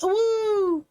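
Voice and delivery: high, long wolf howl; howling like a wolf pup. Acted. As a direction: howl up at the moon. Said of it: "can I get an awoo".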